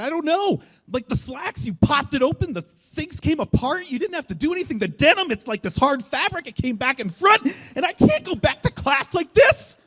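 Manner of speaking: very high, loud panicked outburst; panic-stricken kid spilling out excuses; panicking. Retold or imitated. Sixth grader to best friend after wetting his jeans, panicking. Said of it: "So I am panicking".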